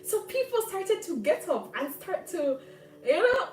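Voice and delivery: high, loud, indignant telling-off; indignant retort. Retold or imitated. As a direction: scold him in a heated, high-pitched outburst. Acted angry retort to the man.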